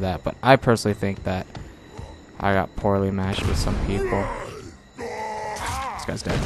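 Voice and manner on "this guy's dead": drawn-out shout. Real, not acted. Gaming shout, slow drawn.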